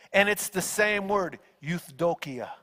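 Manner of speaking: normal talking voice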